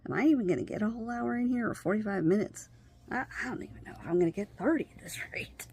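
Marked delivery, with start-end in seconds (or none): 3.1-5.7 fast, soft unvoiced grumble to herself; whispered aside. Real, not acted. Speaker complaining about interruptions to herself, quick whisper. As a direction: rush it out under your breath.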